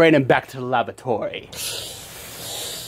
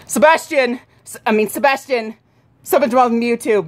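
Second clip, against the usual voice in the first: urgent calling; 8 semitones higher than usual. Acted. Kids acting a panicked scene.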